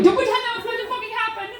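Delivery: high, fast panicked squeal; freaking out. Acted. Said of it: "I'm freaking out".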